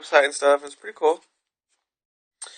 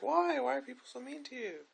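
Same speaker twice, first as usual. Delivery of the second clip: mock doctor's concern; 9 semitones higher, 16 dB quieter than usual. Doctor's sympathetic question.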